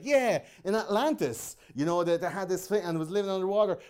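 mock believer voice. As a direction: play a wide-eyed conspiracy nut. Mocking conspiracy-theory believer.

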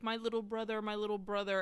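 ordinary delivery

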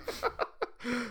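real burst of chuckling; amused laugh. Real, not acted. Cohosts joking about Hootie references, amused laugh. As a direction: crack up at the running joke. Laughing after cutting off the joke.

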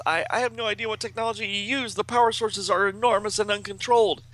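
high, harried comic-book genius; high, agitated voice of an overwhelmed inventor; Brainy. A narrator's reading. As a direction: protest urgently, pitched well up. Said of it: "And, and he says".

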